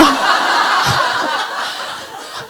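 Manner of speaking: a long, breathy fit of laughter. Real, not acted. Telling viewers how scary it was, a breathy laugh.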